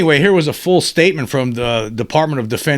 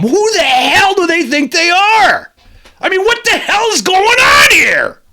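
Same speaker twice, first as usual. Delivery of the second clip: furious shout; 7 semitones higher than usual. Real, not acted. The host erupting over the cover-up, furious shouting. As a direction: yell at the sky in disbelief. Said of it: "I am angry when I think about it".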